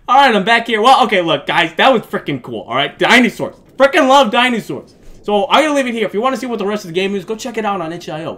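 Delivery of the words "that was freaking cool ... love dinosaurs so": ordinary talk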